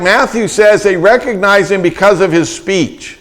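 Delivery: ordinary delivery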